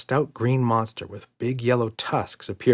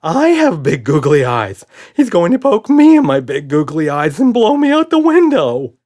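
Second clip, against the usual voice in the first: much higher and louder panic; panicking monster; 8 semitones higher, 13 dB louder than usual. A narrator's reading. Red frightened of super dad, high and loud. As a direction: wail it, terrified.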